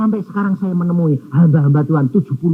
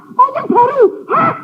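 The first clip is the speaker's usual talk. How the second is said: retold outburst. Pastor retells his own outburst.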